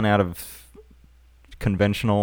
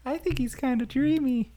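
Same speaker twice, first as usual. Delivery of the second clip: put-on high, swooning teenage voice; gushing teen girl; 13 semitones higher, 9 dB quieter than usual. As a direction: gush like a smitten teenager. Student gossiping.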